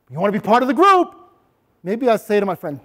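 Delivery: ordinary delivery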